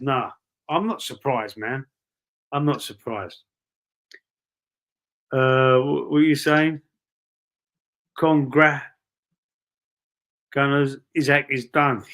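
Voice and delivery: ordinary delivery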